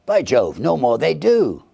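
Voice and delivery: high, loud startled concession; a loud, high "by Jove" of surprise; surprised gentleman. A narrator's reading. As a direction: realise it with a jolt.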